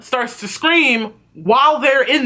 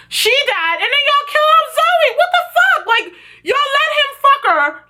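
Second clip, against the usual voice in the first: very high, outraged squeal; pissed off. Real, not acted. Fan protests that they killed off Zoe, high-pitched outrage. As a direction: rant in squeaky disbelief. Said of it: "But I'm pissed".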